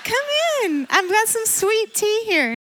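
high, sweet Southern-lady welcome; Southern hostess. Retold or imitated. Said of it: "she's like a Southern woman".

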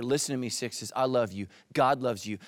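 ordinary talk

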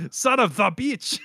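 "Son of the bitch!" with "the" hit hard, slow; mock the dub actor leaning on "the" in "Son of the bitch!"; stress on the. Hosts mocking the English dub line, slow emphatic "the". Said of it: "puts emphasis on the "the".

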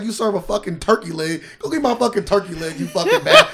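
rising to a squealing laugh; roast insult, laughing. Retold or imitated. Retelling Patrice's roast, cracking up. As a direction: laugh harder as it builds up high.

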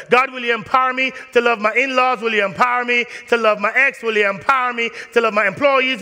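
loud, high, driving preacher cadence; full-force preaching. Acted. Pastor urging the congregation to pray, full-force preaching. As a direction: preach it with fire.

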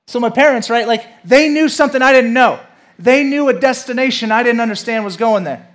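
high, loud preacher's build; a pastor urging the church, loud and high; impassioned preaching. Acted. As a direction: preach it with full force. Preaching at full force.

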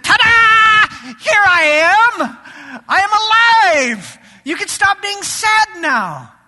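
big, loud, high triumphant announcement; dramatic reveal. Pretend. Jesus bursting out to the grieving travelers, showy and triumphant. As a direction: throw it out like a showman unveiling himself. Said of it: "rolled back the robe dramatically and said".

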